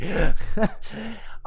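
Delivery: short real laugh at the copy; amused laugh. Real, not acted. Coach laughs at the student's self-defeating line, real laugh.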